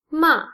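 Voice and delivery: MA short, falling from high; fourth tone. Shown as a demonstration. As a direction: drop the pitch quickly through "ma". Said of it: "is the fourth tone".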